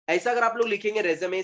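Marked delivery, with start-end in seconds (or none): 1.0-1.4 resume as "re-zuh-may"; three syllables in resume, ending "may"; correct résumé. Shown as a demonstration. Teacher approving this spelling, resume as "re-zuh-may". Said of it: "ये correct है".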